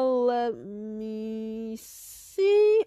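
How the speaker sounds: extremely drawn-out pondering; stalling teacher. Acted. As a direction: stretch every word while hunting the list. Teacher stalls over the roll.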